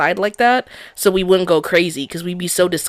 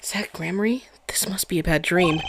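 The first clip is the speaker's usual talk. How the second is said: whispered in disbelief; a hushed whisper of dread; shocked whisper; 6 dB quieter than usual. Acted. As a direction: breathe it out, stunned. Character line read in shock.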